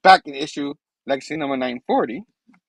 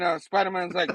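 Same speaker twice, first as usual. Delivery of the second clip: ordinary talk, nothing marked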